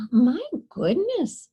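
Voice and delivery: a hint of a fussing grandma; old woman diagnosing Camilla; old woman tutting. A narrator's reading. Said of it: "with a shake of her head".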